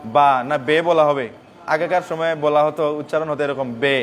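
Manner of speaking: normal talking voice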